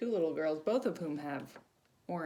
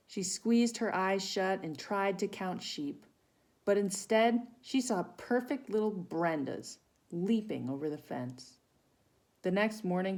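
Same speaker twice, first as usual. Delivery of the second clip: storybook reading; 4 semitones higher, 5 dB louder than usual. Acted. Reads picture book to children.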